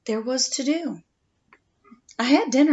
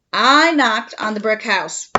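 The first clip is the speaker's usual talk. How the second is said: usual speaking voice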